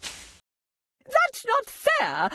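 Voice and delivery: whiny Hare. Acted. Hare repeats complaint.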